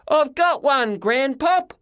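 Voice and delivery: high, loud joyful cry; screaming with joy. A narrator's reading. Boy shouting to grandpa he found the frog, high joyful cry. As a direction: yell it out, overjoyed. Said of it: "I screamed".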